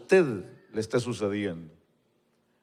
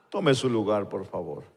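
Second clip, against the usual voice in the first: normal talking voice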